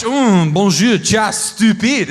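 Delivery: loud, hammed-up French accent; mock-sexy French. Comic trying the insult as French pillow talk, mock-sexy French. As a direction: ooze exaggerated French romance, loudly. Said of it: "Even in French".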